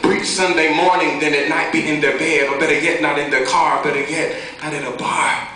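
driving, rhythmic slam-poetry delivery; steady spoken-word flow; spoken-word poem. Acted. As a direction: punch the rhymes as you recite.

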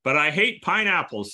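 ordinary delivery

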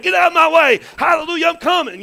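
high, loud revved-up worshiper's cry; imitated urgent shout. Preacher imitating the girl kicking her way up to worship, urgent shout=3. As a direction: holler it like you're charging to the front. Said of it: "she was kicking down there and said".

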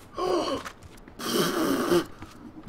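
sudden startled gasp; sharp gasp. Real, not acted. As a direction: gasp in shock.

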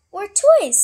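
very high, loud gleeful outburst; excited kitten. Acted. Baby Scaredy Cat guesses at the package, thrilled. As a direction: squeal out a hopeful guess.

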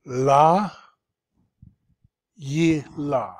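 "La" held loud, pause, "Yi La"; syllable by syllable. Shown as a demonstration. Teacher showing open and closed syllables, "La Yi La" split. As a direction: sound out "La Yi La" in separate syllables. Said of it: "So open, open, closed".

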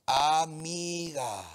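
"Amiga" said very slowly, every syllable held; drawn-out dictation. Shown as a demonstration. Pastor has congregation write it down, drawn-out dictation. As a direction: stretch "Amiga" out long on both vowels.

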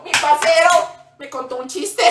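crazy religion teacher. Retold or imitated. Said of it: "y ella gritaba".

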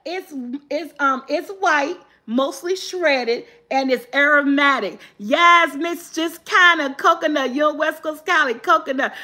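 ordinary talk, nothing marked

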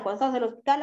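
ordinary delivery

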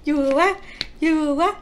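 loud, high sour reaction; exclaiming at sour taste. Real, not acted. Eating sour strawberry.

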